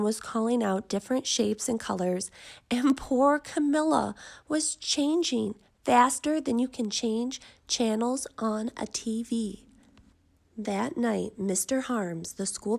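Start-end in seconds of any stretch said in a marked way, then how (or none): none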